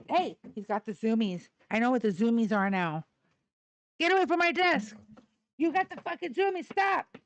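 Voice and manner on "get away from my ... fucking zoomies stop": high, loud scolding of a dog; raised, high-pitched telling-off; sharp scolding. Real, not acted. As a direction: yell at the dog to stop, voice pitched way up.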